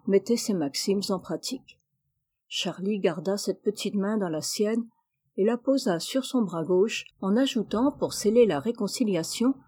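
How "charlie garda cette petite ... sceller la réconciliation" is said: ordinary delivery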